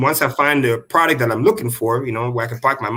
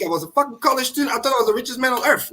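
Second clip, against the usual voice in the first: excited and fast; 9 semitones higher, faster than usual. Real, not acted. Excited memory of first money.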